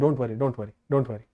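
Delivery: soft, low reassurance; calm the student gently; reassuring. Real, not acted. Teacher reassures student softly.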